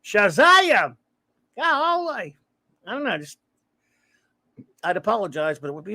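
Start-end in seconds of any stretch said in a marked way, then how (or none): 0.0-2.4 high, loud hillbilly "Golly!"; a goofy Gomer-style exclamation, pitched way up; Jim Nabors impression. Streamer sees a viewer's name and can't resist, goofy high exclamation. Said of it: "a stupid Jim Nabors impersonation".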